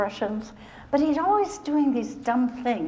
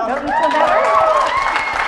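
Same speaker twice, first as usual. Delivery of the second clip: Don't you feel better stretched out, higher and louder; big slow sing-song finish; sing-song delight; 9 semitones higher, 11 dB louder than usual. Real, not acted. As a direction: cheer them on, drawn out. Instructor finishing routine, exaggerated.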